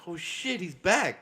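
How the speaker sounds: excited. Retold or imitated. Retold excitement at clip.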